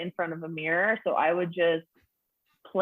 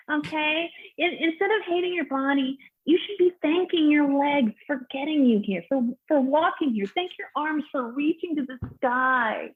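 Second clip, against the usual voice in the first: preachy hipster; 7 semitones higher than usual. Acted. Hipster urging a comic to thank her body, high and preachy. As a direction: lecture sweetly in a high hipster voice. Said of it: "the hipsters come up to me after".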